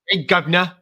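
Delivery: mock British, r dropped at the end; UK accent. Shown as a demonstration. Showing co-host how UK sounds, British accent. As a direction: clip it into a cheeky British "guv'nah". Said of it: "And U K is like".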